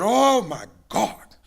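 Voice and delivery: loud, high, drawn-out retold exclamation; excited exclamation. Pastor hooking a big fish, acted excited exclamation. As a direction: cry it out slowly, like landing a catch.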